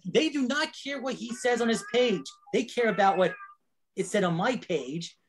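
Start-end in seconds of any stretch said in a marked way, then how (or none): 0.1-0.7 exasperated, pitch up. Real, not acted. Exasperated complaint about Adam.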